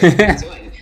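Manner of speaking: laughing disbelief. Real, not acted. Mock-shocked reaction to being called nerd.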